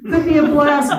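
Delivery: mock Appalachian boss raising a silly alarm; Appalachian drawl. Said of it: "Appalachian drawl".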